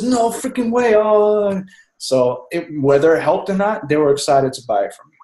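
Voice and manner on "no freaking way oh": high, drawn-out imitation of an astonished salesman; mock-astonished outburst; amazed disbelief. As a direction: mimic someone floored by the news, pitch jumping up.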